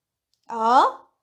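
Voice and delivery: doubt it with a loud, dipping-then-rising "啊"; doubtful. Shown as a demonstration. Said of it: "expressing doubts".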